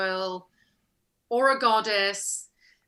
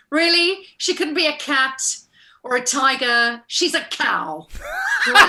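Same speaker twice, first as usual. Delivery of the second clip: comically appalled, stretching the last words; mock disbelief; 4 semitones higher, 6 dB louder than usual. Actress objecting to her goddess being a cow, mock incredulous.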